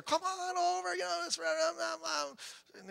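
put-on coaxing girl. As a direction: lightly play the girl pleading come over. I kept avoiding answering her.

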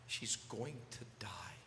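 slow broken whisper; a father whispering the worst news; whispered grief. Retold or imitated. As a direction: barely breathe the words out. Grieving father in hospital.

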